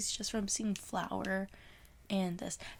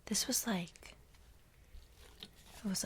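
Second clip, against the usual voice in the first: whisper; 7 dB quieter, slower than usual. Real, not acted. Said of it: "building suspense before quote".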